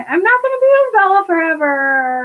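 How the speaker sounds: high, whiny mock lament; hammed-up self-pitying cry; mock wailing. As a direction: wail it out, stretching the last word. Host mocks character's self-deception.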